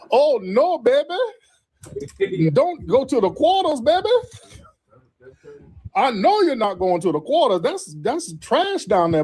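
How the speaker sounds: put-on high, sing-song auntie voice calling everyone baby; New Orleans local. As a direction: play a sassy New Orleans auntie scolding you away from the Quarter. Voicing New Orleans locals warning.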